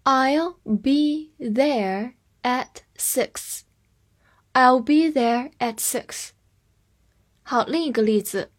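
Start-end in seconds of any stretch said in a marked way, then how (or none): 0.0-3.6 word by word, very slowly, with pauses; slow teacher's model reading. Shown as a demonstration. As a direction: stretch each word out separately for learners. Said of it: "我们慢读一遍".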